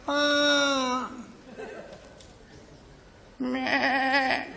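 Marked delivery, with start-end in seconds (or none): 3.4-4.5 soft, far-off bleating goat. Pretend. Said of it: "到羊圈了".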